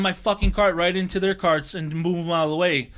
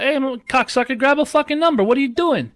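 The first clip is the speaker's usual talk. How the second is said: higher, snapping call at the line-cutter; angry at line-cutter; 10 semitones higher, 3 dB louder than usual. Retold or imitated. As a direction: tell the cutter off sharply, pitched up. Yelling at deli line-cutter.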